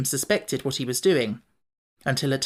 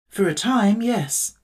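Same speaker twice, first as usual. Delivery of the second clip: Tin Woodman voice; 6 semitones higher, slower than usual. A narrator's reading.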